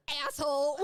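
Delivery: put-on high laughing kid voice; imitating little kid. Retelling little brother copying dad's swear, laughing. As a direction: mimic a toddler repeating the swear. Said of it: "my little brother goes".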